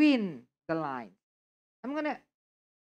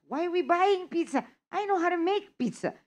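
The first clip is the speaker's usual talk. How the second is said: higher, fast insisting tone; convincing a friend; 9 semitones higher, faster than usual. Shown as a demonstration. Teacher acting out persuading a friend, eager and insistent. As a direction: push the friend to agree, quick and high. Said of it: "you're trying to convince your friend".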